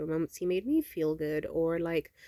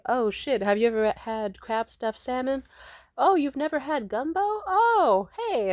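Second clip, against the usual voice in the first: excited; 6 semitones higher, 5 dB louder than usual. Retold or imitated. Excited food chat retold.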